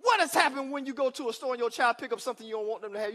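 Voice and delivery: ordinary delivery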